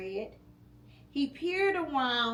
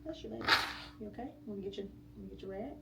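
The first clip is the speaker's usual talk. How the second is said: much softer comforting words; tender aside; 2 semitones lower, 7 dB quieter, much faster than usual. Real, not acted. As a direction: soothe the little one quietly. Parent aside to child.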